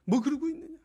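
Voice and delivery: loud, exasperated jeering; mock scolding. Preacher imagining how he'd scold Gideon, mock exasperated. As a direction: tut and scoff at a useless man. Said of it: "저 같으면 그렇게 불렀을 것 같아요".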